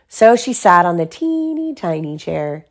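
ordinary delivery, nothing marked

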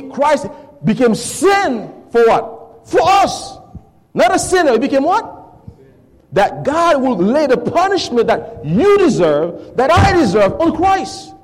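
high, loud preaching cry; impassioned preaching. Real, not acted. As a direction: lift the congregation with a rising call. Preaching climax.

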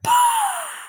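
a high, sharp gasp for air; retold gasp of relief. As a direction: gasp like someone just pulled up out of the water. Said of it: "she gasped for air".

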